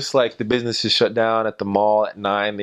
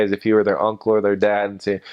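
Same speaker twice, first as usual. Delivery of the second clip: normal talking voice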